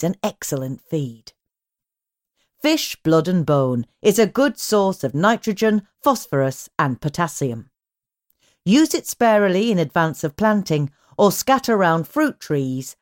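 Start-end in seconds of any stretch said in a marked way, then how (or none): none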